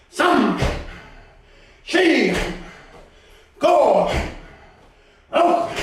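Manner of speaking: high, punchy karate count; drill count call. Shown as a demonstration. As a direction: call each count as a strike command. Karate coach counts out repetitions.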